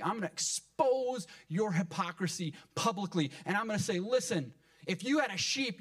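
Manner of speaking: high, heated preacher's rebuke; impassioned confrontation. Retold or imitated. Jesus exposing his accusers' hypocrisy in the synagogue, heated rebuke.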